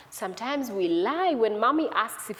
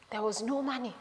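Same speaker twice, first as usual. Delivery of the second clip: soft, let-down narration; quietly deflated; hushed discovery; 7 dB quieter than usual. A narrator's reading. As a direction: drop the voice on the bad news. First discovery of empty pocket.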